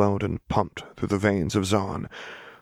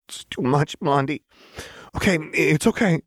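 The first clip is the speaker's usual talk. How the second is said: overwhelmed; 10 semitones higher than usual. Acted. Jinx overwhelmed, Lux soothing.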